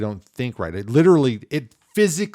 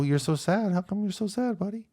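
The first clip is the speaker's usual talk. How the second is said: tender dad; 8 semitones higher, 4 dB quieter than usual. Retold or imitated. Dad consoling son.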